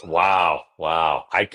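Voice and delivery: ordinary talk, nothing marked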